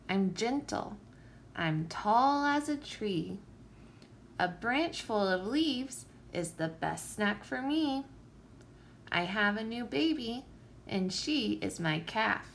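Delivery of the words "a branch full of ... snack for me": plain reading voice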